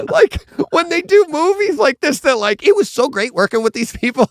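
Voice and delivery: high, loud, sing-song parody of press-tour praise; mock gushing actor. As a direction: gush like a phony actor in an interview. Mocking fake press-tour gushing.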